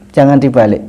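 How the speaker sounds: ordinary talk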